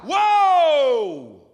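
high, drawn-out whoop falling away; exaggerated cheer. Shown as a demonstration. Preacher showing how to cheer a child, exaggerated whoop. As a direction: whoop as if at a touchdown. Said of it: "say it to a child".

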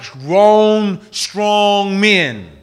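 emphatic. Retold or imitated. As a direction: stretch each word out with force. Stretches the description of creatures' voices.

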